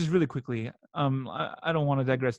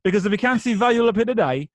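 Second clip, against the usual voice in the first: a Snatch-style Cockney sales patter, louder and higher; Cockney accent, pitched up and punchy; Cockney quote; 4 semitones higher, 4 dB louder than usual. Retold or imitated. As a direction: rattle it off like a Cockney street dealer. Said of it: "I'd always be like".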